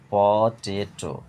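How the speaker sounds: পটেটো very slow as প, টে, টো; split in three syllables. Shown as a demonstration. Teacher demonstrating syllables, পটেটো in three slow parts. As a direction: stretch each beat of পটেটো. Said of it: "তিনবারে ভেঙে উচ্চারণ করা হয়".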